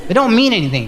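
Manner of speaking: normal talking voice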